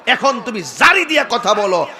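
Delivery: usual speaking voice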